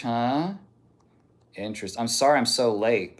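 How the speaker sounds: ordinary talk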